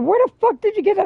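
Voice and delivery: very high, loud, fast shocked question; shocked friend. Retold or imitated. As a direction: gasp it out in disbelief, high and fast. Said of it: "She said".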